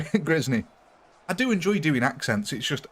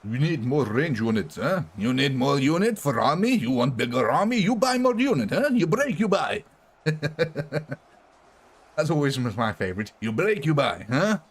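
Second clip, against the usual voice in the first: shopkeeper voice; 3 semitones higher than usual. Pretend. Shopkeeper voice bit.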